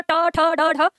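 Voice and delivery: slow, separate ट ठ ड ढ with curled tongue; retroflex consonant demo. Shown as a demonstration. Teacher drills tongue position, ट ठ ड ढ slow and retroflex. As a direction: space out ट ठ ड ढ one at a time. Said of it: "ट ठ बोलते हो ना".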